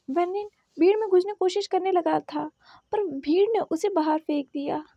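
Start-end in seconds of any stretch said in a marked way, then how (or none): none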